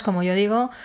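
ordinary delivery

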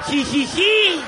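high, loud mocking "jijiji" giggle; a smug little texting giggle, hammed up. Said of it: "así teclean las mujeres". (risita burlona "jijiji")

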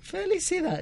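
un toque de presentador alegre, más agudo; mock cheer. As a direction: celebra en broma como animador. Joins mock casino announcement. (animador en broma, voz más aguda)

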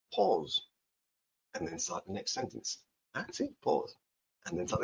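soft words with a clear pause before "and then start"; showing a pause between sentences; demonstrating a pause. Shown as a demonstration. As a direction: stop fully, then start the next sentence. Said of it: "the pause that I made there?".

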